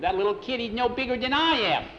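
high, climbing protest; acted boyish outrage, voice way up; indignant disbelief. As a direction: object in disbelief, pitch climbing to the end. Rejected boy objects to small kid.

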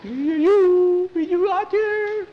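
very high, slow trembling call; a child calling into the dark; scared calling out. Acted. Skeeter calls into dark.

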